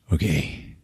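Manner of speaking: low, soft acknowledgement; calm. Retold or imitated. Stone sitting down before the chief, calm. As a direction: say it quietly and unbothered. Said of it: "calmly says".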